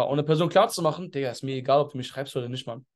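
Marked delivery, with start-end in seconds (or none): 1.1-2.9 low, soft "whatever, bro"; deliberately indifferent. Shown as a demonstration. Coach showing the prospect he doesn't care, low and soft. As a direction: let it drop with a careless shrug. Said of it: "Ich mach's extra".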